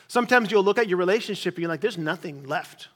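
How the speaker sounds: usual speaking voice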